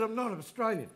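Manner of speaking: high-pitched retold protest; indignant protest. Student insisting to classmate, indignant protest.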